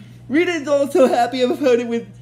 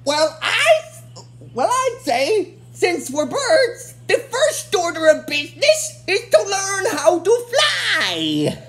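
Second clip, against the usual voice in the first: higher, slow bird-monster voice; another Tenga; 7 semitones higher, slower than usual. Retold or imitated. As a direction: deliver it with pompous slowness. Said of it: "one of the other Tengas says".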